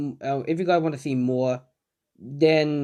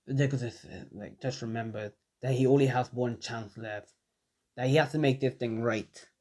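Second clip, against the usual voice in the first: usual speaking voice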